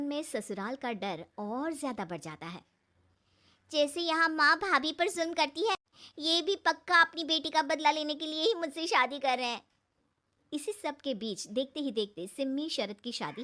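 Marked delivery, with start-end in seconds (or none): 3.7-9.7 put-on worried girl thinking aloud, higher and louder; anxious young woman's voice, pitched up; fearful bride-to-be. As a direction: convince yourself the in-laws want revenge. Narrator voices Simmi's fearful thought.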